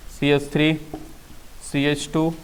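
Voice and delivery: ordinary talk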